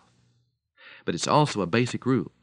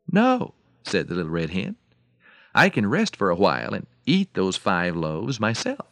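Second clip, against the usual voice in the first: lively fable telling with louder, higher animal lines; animated storyteller with big swings in pitch; story narration; 5 semitones higher, 5 dB louder than usual. A narrator's reading. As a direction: tell it like a children's tale, punching the dialogue. Tells animal fable dramatically.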